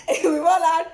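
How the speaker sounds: loud, high laugh-crying; laughing to tears. Real, not acted. Woman laughing about friend flirting with her uncle. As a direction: crack up while talking.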